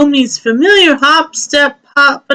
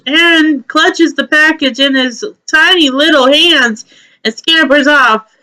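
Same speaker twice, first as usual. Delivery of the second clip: ordinary delivery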